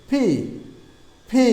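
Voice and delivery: "ফি" started with both lips together; two-lip P. Shown as a demonstration. Teacher shows students the letter P, both lips on "ফি". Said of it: "দুই ঠোঁট দিয়ে উচ্চারণ করতে হবে".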